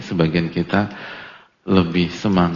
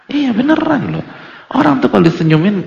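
excited insistence; 14 semitones higher, 4 dB louder than usual. Real, not acted. Penegasan bersemangat.

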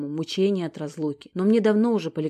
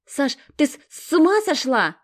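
much higher, stung and indignant; offended; 9 semitones higher, 7 dB louder than usual. Acted. Stezhka, hurt by Sasha's crude joke, high and indignant. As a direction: recoil as if slapped by the words. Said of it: "обиделась Стежка".

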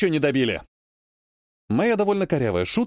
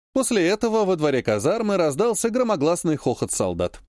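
ordinary delivery, nothing marked

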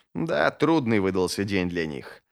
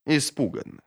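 usual speaking voice

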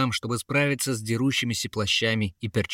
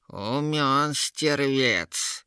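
very slow, higher creature drawl; Yera sneering; 4 semitones higher, much slower than usual. A narrator's reading. Troll-woman on the clever guard, drawn-out sneer. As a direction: drawl out grudging, amused admiration. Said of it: "усмехнулась Ера".

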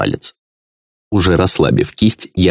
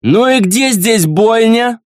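put-on high, slow, slurred drunk prince; sway and slur like a drunk noble; slower than usual. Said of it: "донёсся от входа пьяный голос".

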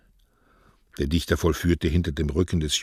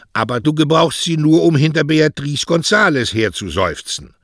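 put-on higher, louder teasing old poet; mocking poet; 5 semitones higher, 7 dB louder than usual. Poet teasing the lovesick postman, higher and loud. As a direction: rib the lovesick boy with gusto.

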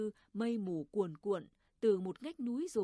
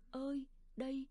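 distant echo; 5 semitones higher than usual. A narrator's reading. Mountains throwing back the mother's call, echoing high. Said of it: "tiếng núi âm âm dội lại tiếng gọi của bà".

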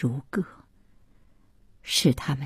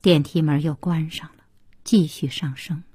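usual speaking voice

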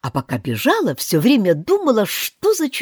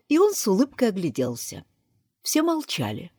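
ordinary delivery

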